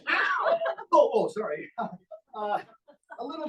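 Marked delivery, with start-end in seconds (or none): 0.1-0.6 high shouted puppet "Ow"; puppet yelp. Acted. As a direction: yelp like a hurt little puppet.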